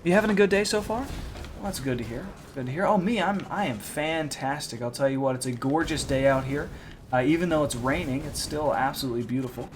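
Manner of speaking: quick, upbeat, rehearsed sales patter; practiced car salesman on the phone; well-practiced phone voice. Retold or imitated. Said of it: "More well-practiced robot".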